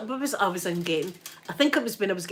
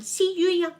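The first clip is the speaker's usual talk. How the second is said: high mock yell out a car window; angry driver lady yelling at them over a parking space, high mock yell; mock shouting lady; 10 semitones higher than usual. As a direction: imitate an angry woman yelling from her car. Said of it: "hanging out her car window going".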